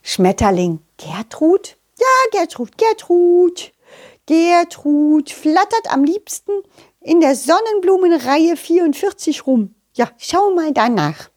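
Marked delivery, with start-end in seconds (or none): 2.0-11.3 high butterfly voice, giving directions; helpful butterfly. Acted. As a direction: explain high and slightly singsong, as a helpful little creature.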